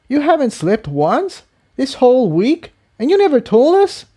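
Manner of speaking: can't believe he hid this from them; shocked disbelief. Acted. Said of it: "eyes widened with surprise".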